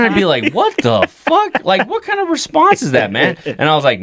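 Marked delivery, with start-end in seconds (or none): 0.5-3.4 high incredulous outburst. Retold or imitated. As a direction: squeak it out in disbelief. Son retells reacting to dad.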